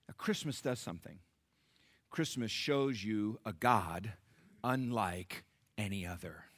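usual speaking voice

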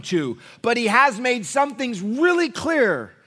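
loud, high, slow pulpit climax; full-force preaching. Acted. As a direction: hammer the point home to the church.

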